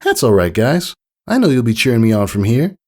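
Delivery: lightly voiced filly, putting on a brave face; a hint of forced cheer. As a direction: hide the disappointment and reassure friends. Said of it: "put on a brave face".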